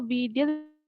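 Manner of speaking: breaking up on a weak line; bad connection. Real, not acted. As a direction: voice cutting out over a call. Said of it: "I'm sorry for the bad connection".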